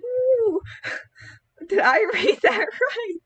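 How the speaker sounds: high, squeaky disbelief breaking into laughter; hammed-up shocked giggle; amused disbelief. Acts own stunned reaction to the line.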